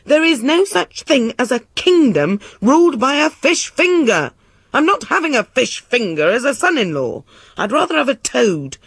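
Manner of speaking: high-pitched indignant rant; furious king's protest; screaming outrage. A narrator's reading. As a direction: rage at the enchanter, voice pushed high. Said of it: "screamed the king".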